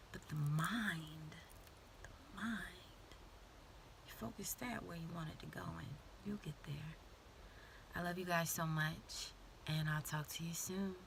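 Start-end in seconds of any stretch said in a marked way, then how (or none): 0.1-7.0 intimate whisper. Real, not acted. Closing motivational advice.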